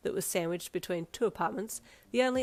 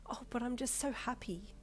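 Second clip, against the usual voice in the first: delighted chuckle; 5 semitones higher, 7 dB quieter than usual. Acted. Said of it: "with a delighted chuckle".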